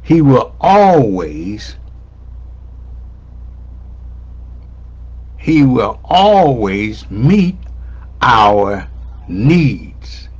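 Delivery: "always" drawn out slowly, weight on it; emphatic. Real, not acted. Preacher emphasizes God's promise to congregation, slow and emphatic. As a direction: stretch "always", then set "needs" apart. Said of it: "I need to emphasize".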